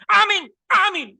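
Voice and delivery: very high, loud mock amen; teriakan amin. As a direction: imitate worshippers just yelling amen. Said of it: "pokoknya teriak". (meniru jemaat berteriak amin)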